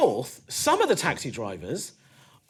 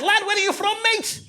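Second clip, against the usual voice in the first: high, loud Scouse cabbie; overexcited Scouse greeting, pitched way up; Scouse taxi driver; 14 semitones higher, 4 dB louder than usual. Acted. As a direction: play a hammed-up Scouse driver. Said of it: "this Scouse taxi driver".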